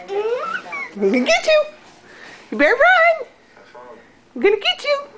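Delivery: high, squeaky mock threat; sing-song I'm gonna get you; playful chase voice. Real, not acted. Chasing game with baby.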